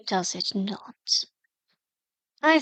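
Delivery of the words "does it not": whisper. Acted. Mystic pressing the advisor softly.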